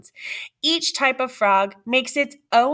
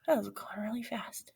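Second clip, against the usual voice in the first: hushed whisper; 14 dB quieter, faster than usual. Real, not acted. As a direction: whisper it softly. Whisper heard.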